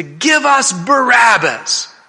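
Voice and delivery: high, drawn-out loud demand; crowd crying out. Retold or imitated. Mob demands a prisoner from Pilate, crying out. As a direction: shout it slowly as a mob would. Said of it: "they start to cry".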